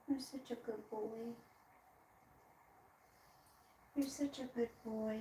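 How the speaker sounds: very soft, loving murmur; tender whisper to pet. Real, not acted. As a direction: murmur softly to the dog. Tender words to the dog.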